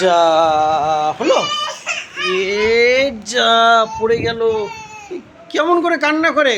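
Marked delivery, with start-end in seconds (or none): 0.0-4.4 playful dismay. Pretend. Reacting to child's dropped fruit.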